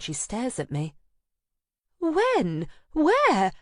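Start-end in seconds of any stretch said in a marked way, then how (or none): 2.0-3.5 high, loud urgent questions; shocked friend. Acted. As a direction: fire the questions in alarm. Anna shocked by the news.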